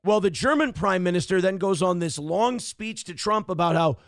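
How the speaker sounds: ordinary talk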